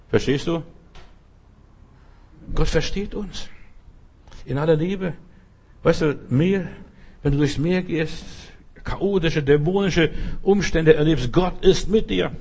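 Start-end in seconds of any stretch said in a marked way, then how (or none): none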